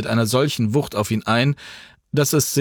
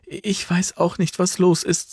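put-on higher, agitated young woman; worried granddaughter rushing her words; excited, flustered; 8 semitones higher than usual. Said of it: "sagte die Enkelin aufgeregt".

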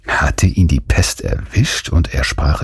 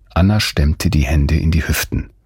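ordinary delivery

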